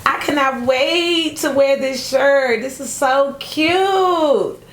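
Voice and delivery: high, stretched-out excitement; slow, high-pitched gushing; gushing delight. Real, not acted. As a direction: draw out the key words in delight. Gushing over the shirt she got.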